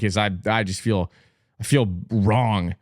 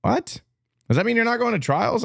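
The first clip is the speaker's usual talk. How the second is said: shocked; 12 semitones higher than usual. Real, not acted. Learns swimmer is Norwegian.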